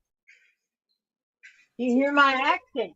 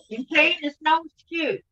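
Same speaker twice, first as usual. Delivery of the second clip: ordinary talk, nothing marked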